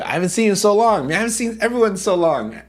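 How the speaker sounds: high, fast, gushing greeting; really excited. Real, not acted. Streamer greets returning viewers after a month away, really excited. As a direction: rush it out, pitched right up with excitement. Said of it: "I'm just really excited".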